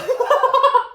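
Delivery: a loud, high burst of laughter. Real, not acted. Admitting he skipped Greek life, loud high laughter. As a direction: let out a big high laugh.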